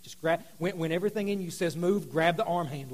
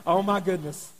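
ordinary talk

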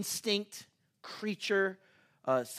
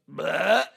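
loud mock belch; friend's burp, imitated; 8 dB louder than usual. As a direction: burp out a short "bleh". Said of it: "not bad enough that he burped".

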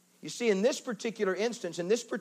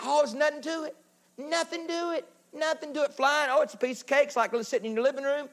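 mock breezy reassurance; 8 semitones higher than usual. Mimics breezy reassurance about flying.

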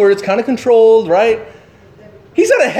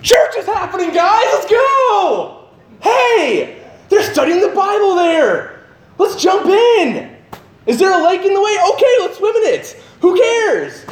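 rally your friends, can't wait; enthusiastic like Peter; 13 semitones higher, slower than usual. Retold or imitated. Believer urging friends to church like Peter, excited calls. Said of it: "What if we were like Peter?".

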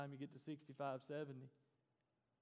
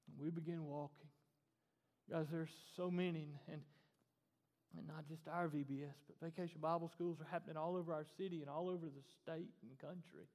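ordinary delivery

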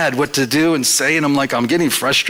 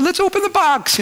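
let's try it, pitched way up; eager and much higher. Retold or imitated. To the installer, eager and much higher. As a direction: push the idea with bright eagerness.

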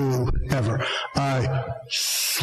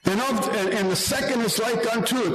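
scripture reading; 5 semitones higher than usual. Acted. Reads scripture aloud.

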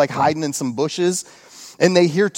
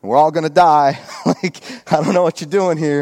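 exasperated Gideon; 5 dB louder than usual. Retold or imitated. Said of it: "Gideon's like".

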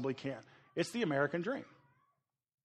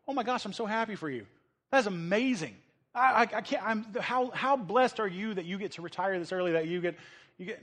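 higher, fast, gushing congratulations; mock-gush over a friend's early retirement; gushing praise; 4 semitones higher than usual. Imitating church folk praising an early retiree, gushing. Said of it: "don't you go".

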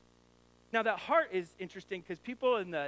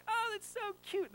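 put-on much higher, sugary coo at other kids; sweet gushing voice. Father cooing at a neighbor's naughty child, high and sweet. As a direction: gush sweetly over someone else's child. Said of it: "I'll be like".

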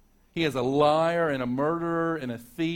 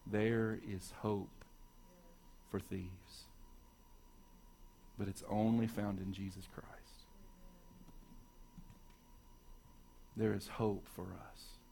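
hushed; 5 semitones lower, 13 dB quieter than usual. Real, not acted. Preacher to his congregation, offering hope in a hush. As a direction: drop to a hush and let it sink in.

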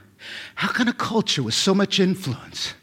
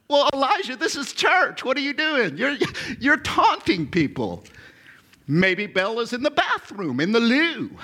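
higher, amused teasing; playful mock scolding; 8 semitones higher than usual. Preacher playfully scolding Elijah for taunting, amused. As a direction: tease him like a cheeky friend.

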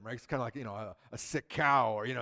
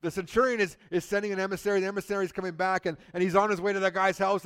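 ordinary talk, nothing marked

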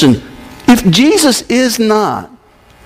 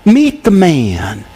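very slow, each word given weight; slower, emphatic; 6 semitones lower, much slower than usual. Shown as a demonstration. Preacher repeating the charge to the congregation, very slow. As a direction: land meet the man slowly. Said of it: "read it through again slowly".

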